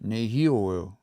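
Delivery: nehioeo, stressed on the second syllable, hi; stress moved over one. Shown as a demonstration. Teacher models nehioeo for repetition, stress on hi. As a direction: punch hi in nehioeo, then fall away. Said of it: "It moves over one".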